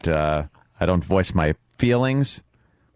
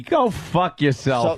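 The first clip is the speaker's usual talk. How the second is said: slow, higher sneering tough-guy voice; mocking tough guy; 5 semitones higher, slower than usual. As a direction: ham up the rude stranger's bravado.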